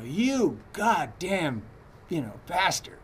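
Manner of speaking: slow, drawn-out mock angry curse; retold furious insult. Teacher retelling a furious student's curse, mock anger. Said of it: "she was furious at me".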